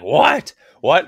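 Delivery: high shocked double what; dad can't believe it, pitch jumps up; dad's shocked what. Retold or imitated. Retelling dad's shock at no socks, high surprised yelp. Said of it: "He's like".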